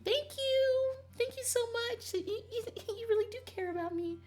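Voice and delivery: tiny, very high squeaky voice; mock-touched, high-pitched gushing; gushing thanks. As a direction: squeal it in a hammed-up cutesy falsetto.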